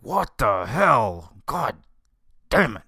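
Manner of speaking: drawn-out, mock-outraged cursing; retold exasperation. Host reenacts finding his recording on the wrong track, exasperated. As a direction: act out a frustrated outburst, each word stretched and punched. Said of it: "it pissed me off".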